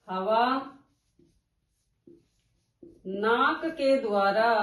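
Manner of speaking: slow, word by word with long gaps; slow dictation. Shown as a demonstration. Teacher dictating the definition slowly to students. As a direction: dictate each phrase slowly for notes. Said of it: "जिन वर्णों का उच्चारण करते समय हवा नाक के द्वारा".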